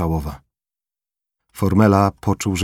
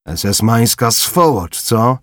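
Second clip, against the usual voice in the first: slow, louder, higher contempt; sneering insult; 5 semitones higher, 4 dB louder, slower than usual. Acted. Corporal sneering at the dead German, slow contemptuous jeer. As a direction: spit out the insult slowly.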